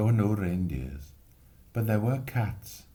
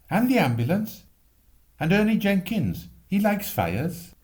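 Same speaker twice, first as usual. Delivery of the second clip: put-on eager boy; 11 semitones higher, 4 dB louder than usual. Excited boy Jim.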